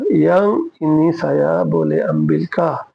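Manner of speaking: stretched almost syllable by syllable; slow it down even further for the class; very slow repeat. Shown as a demonstration. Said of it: "বলে আমবিল কা?".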